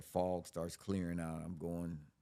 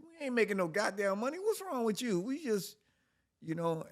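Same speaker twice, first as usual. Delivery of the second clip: much higher and louder outrage; exasperated; 7 dB louder than usual. Retold or imitated. Arguing with girlfriend about money, much higher and louder. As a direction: throw up your hands at her. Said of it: "I said".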